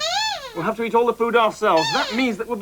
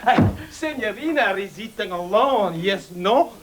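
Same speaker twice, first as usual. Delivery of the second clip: hammed-up Italian waiter welcome; mock Italian accent; 10 semitones lower than usual. Waiter greeting and seating a lone diner, mock Italian accent. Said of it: "the accent there".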